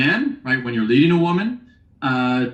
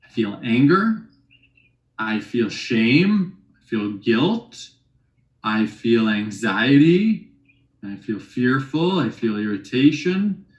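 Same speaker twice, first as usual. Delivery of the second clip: slow feeling words; 3 semitones lower, slower than usual. Shown as a demonstration. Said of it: "the third one is feelings".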